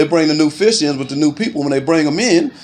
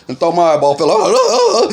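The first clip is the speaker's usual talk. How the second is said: real laughter breaking into the line; laughing through words; 2 semitones higher, slower than usual. Real, not acted. Telling friends about his eye, laughing through it. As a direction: laugh as you retell it.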